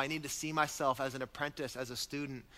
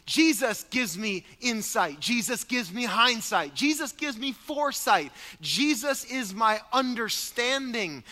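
loud, higher, rhythmic preaching; full-force preaching; 6 semitones higher, 6 dB louder than usual. Acted. Pastor rallies the congregation, preaching loud and high. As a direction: hammer each "Jesus is my" line home.

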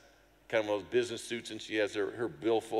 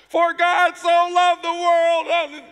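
bellowed much higher and louder, very slow; loud slow proclaiming; 14 dB louder, much slower than usual. Retold or imitated. Urged by the interpreter to preach over the babbling, booming. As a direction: shout it out over the crowd, stretching each word. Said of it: "louder, louder".

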